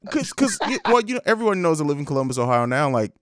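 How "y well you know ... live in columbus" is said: normal talking voice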